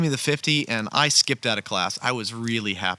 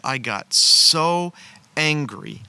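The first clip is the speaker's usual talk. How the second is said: drawn out, louder and higher; building outrage; 4 semitones higher, 5 dB louder, much slower than usual. Retold or imitated. Telling listeners about his anger at the professor, slow and emphatic. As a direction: stretch out how angry you got.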